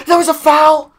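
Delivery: high, loud indignant shout; outraged yell. Real, not acted. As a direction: yell it like the ref robbed you. Outraged gamer at a foul call.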